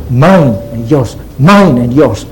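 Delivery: "mine" and "yours" each weighted; stress on MINE and YOURS. Shown as a demonstration. Preacher showing the congregation the emphasis, stressing "mine" and "yours". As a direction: lean on "mine", then on "yours". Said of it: "Note the emphasis".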